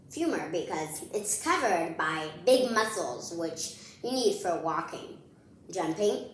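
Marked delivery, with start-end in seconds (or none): none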